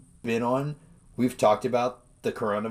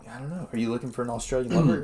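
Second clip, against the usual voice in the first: usual speaking voice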